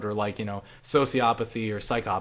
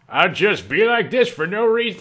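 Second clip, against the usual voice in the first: high, loud, swooping silly voice; hammed-up crazy muttering turned loud; crazy person voice; 6 semitones higher, 6 dB louder than usual. Shown as a demonstration. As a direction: put on a loopy self-talk voice. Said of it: "talk to myself like a crazy homeless person".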